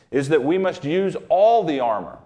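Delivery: normal talking voice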